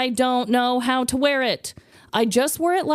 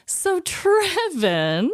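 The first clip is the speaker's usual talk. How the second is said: drawn-out cue; 7 semitones higher, much slower than usual. Acted.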